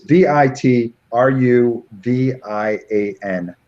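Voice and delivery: slow, careful letter-by-letter spelling; spelled out slowly. Shown as a demonstration. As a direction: spell each letter deliberately. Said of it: "Uh, virtu".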